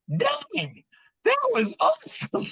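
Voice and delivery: very high, squeaky excited voice; hammed-up thrilled squeal; imitating amazed son. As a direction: gush in mock awe, way up high. Voices son's amazed reaction.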